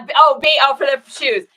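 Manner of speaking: high, loud laugh as the joke lands; laughing realization. Real, not acted. Laughing as she finally gets Denise's joke. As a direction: burst out laughing at the pun.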